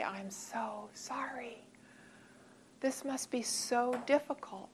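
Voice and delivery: slow, whispered, very soft condolence; gentle sympathy. Shown as a demonstration. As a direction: lean in and sympathize quietly. Modelling words for someone in crisis.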